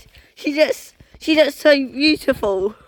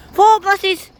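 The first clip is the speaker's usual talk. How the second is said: excited; 10 semitones higher, 6 dB louder than usual. Real, not acted. Excited cry at four buses.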